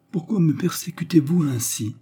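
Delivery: a strained, anguished whisper, voice catching; anguished broken voice. A narrator's reading. Girl pleading with her questioner in a broken whisper. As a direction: whisper it through tears of irritation. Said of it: "d'une voix entrecoupée par l'angoisse et l'irritation".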